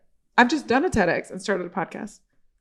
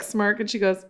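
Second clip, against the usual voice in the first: ordinary talk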